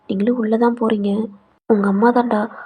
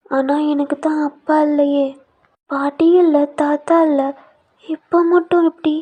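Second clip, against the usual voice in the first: put-on small child's voice; 4 semitones higher, 4 dB louder, slower than usual. Son questions his grandparents.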